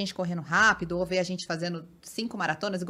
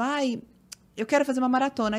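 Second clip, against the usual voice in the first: mock eager newbie; 4 semitones higher, 3 dB louder than usual. Mocking eager beginner runners.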